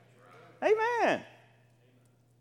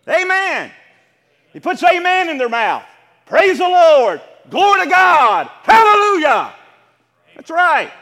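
preaching shout; 6 semitones higher, 14 dB louder, slower than usual. Real, not acted. Preacher rising to a climax.

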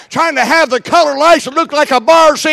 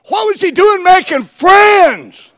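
incredulous preacher shout; 7 semitones higher, slower than usual. Real, not acted. Preacher asking congregation about Samson, slow incredulous shout. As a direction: hammer the question out slowly.

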